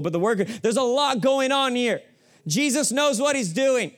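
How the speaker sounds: fiery sermon climax; rising preaching intensity. Acted. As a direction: preach it up to a peak. Preaching builds to full force.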